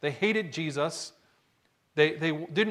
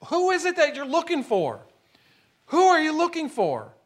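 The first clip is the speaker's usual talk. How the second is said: gardener asking; 9 semitones higher than usual. Retold or imitated. Landscaper asks Mary.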